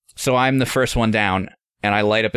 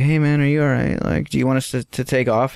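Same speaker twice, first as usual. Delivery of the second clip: higher, gently probing question; retold concerned check-in; 3 semitones higher than usual. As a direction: check politely on someone, pitched up. Checking on unwell host.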